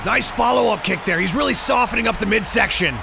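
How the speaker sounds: ordinary delivery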